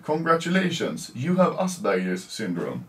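doctor voice. Retold or imitated. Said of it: "I usually say to the child".

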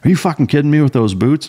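high-pitched mock dressing-down; nitpicking drill instructor. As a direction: pick on a recruit's boots in disbelief. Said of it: "you were nitpicking my fucking uniform".

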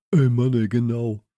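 loud, emphatic exclamation; boy loudly agreeing; calling out. A narrator's reading. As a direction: call out in eager agreement. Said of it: "rief Friedhelm".

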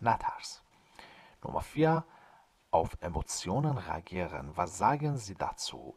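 ordinary delivery

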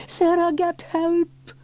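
high, drawn-out plea; stretched high-pitched child's cry; boy's cry for help. A narrator's reading. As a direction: call out slowly and high as a boy in danger. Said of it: "he cried".

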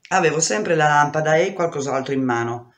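plain reading voice

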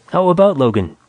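upbeat optimist echoing Mac's words; cheerful Logan. A narrator's reading. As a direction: toss the question back with a grin.

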